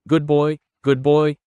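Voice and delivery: put-on bright praising good boy, said twice; praise tone. Shown as a demonstration. Trainer showing the praise tone for the dog. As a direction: praise the dog so it wants to come. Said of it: "The first is the tone of our voice".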